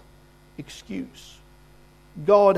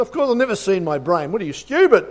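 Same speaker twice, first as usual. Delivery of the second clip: louder, rising to a scoffing stupid; scornful retort; 3 semitones higher, 6 dB louder than usual. Retold or imitated. Sick engineer mocking the preacher's question, louder and scornful. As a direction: scoff at him like he is a fool.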